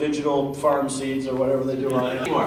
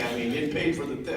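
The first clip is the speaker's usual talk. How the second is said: normal talking voice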